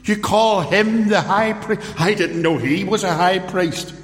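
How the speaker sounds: Paul mocking the high priest's title; cynical mock surprise. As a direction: throw the title back at him, sneering innocence. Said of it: "condemnation by cynicism".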